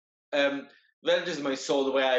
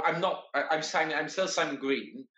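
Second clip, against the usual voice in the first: ordinary talk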